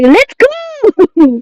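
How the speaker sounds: high, urgent cartoon-alien shout of "Let's go"; dubbed Zim yelling. Spanish-dub Zim hurrying his computer, high yelled "Let's go". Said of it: "He's like".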